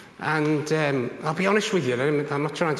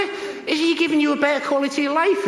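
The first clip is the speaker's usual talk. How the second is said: excited; 13 semitones higher, 5 dB louder than usual. Retold or imitated. Asking the brother, mid-punchline.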